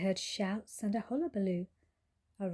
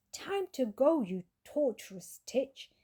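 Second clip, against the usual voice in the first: triumphant cat; 4 semitones higher, 3 dB louder than usual. A narrator's reading. Cat switches on Hoover.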